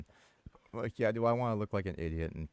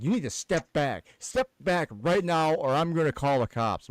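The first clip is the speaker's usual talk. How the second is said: higher, louder, slow bossy order; mock threat; 9 semitones higher, 5 dB louder than usual. Lady threatening teller with the cops, mock bossy order. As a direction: imitate her threatening to call cops. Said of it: "And she's like".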